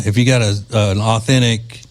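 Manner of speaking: normal talking voice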